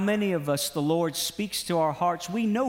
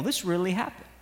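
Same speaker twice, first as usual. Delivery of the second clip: ordinary delivery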